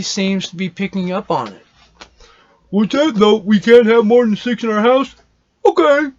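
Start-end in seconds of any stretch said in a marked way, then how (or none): 2.7-6.1 higher, louder put-on voice; mocking imitation, pitched up and loud; mock compliant citizen. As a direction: act out someone going along with the rule. Mimics people meekly accepting house limits.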